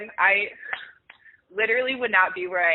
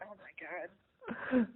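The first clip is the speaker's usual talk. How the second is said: much softer, very slow hush; awestruck; 11 dB quieter, much slower than usual. Retold or imitated. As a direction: breathe it out in disbelief. Star walks behind him.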